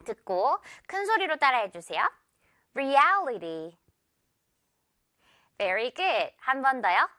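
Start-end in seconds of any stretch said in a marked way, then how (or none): 2.7-3.8 Reality slowly, with an elastic long "al"; stretched middle syllable. Shown as a demonstration. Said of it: "고무줄처럼 늘어뜨리는".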